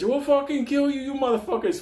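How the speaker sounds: high, hammed-up threat; mock death threat. Robbers threatening the man on the ledge, high mock threat. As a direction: yelp it like a cartoon thug.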